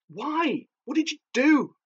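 high, bewildered questions; high-pitched, panicked disbelief; alarmed questioning. A narrator's reading. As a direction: ask it in rising alarm. Frightened narrator questions auntie while fleeing.

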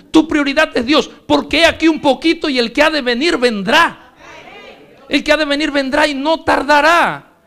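full-force preaching. Acted. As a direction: preach each phrase slowly and forcefully.

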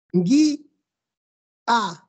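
"Gui-, a" in two beats with a long pause; syllable-split pronunciation. Shown as a demonstration. Teacher making students hear the hiatus, "Gui-, a" split apart. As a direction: raise "Gui-", pause, then drop to "a". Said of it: "lean bien".

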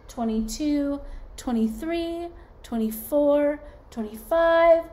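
put-on sing-song counting for little kids; counting aloud. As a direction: count along brightly with the class. Teacher counting aloud for children.

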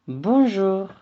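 bonjour with zh sound and silent r; French bonjour. Shown as a demonstration. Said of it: "Diğer bir kelimemiz".

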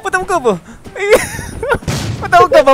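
high, urgent repeated cries breaking into a squeal; panicked pleading. Real, not acted. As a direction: blurt it out in a high game panic. Panicking while being attacked in game. (taas nga tingog, nataranta)

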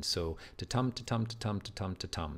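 tapping out ta-TUM ta-TUM with the stress on TUM; rhythmic da-DUM demonstration of the meter; iambic beat demo. Shown as a demonstration. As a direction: chant ta-tum evenly, hitting every second beat. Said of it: "That's iambic pentameter".

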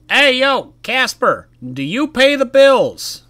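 high, loud, slow mock-parent shout; mock yell. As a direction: holler like an angry parent at the kid. Yelling at the door slammer.

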